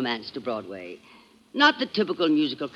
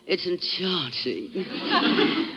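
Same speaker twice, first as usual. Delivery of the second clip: drawn-out, soft and dreamy; swooning; 6 dB quieter, much slower than usual. Acted. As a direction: melt over the word. Swooning reply to Pinza.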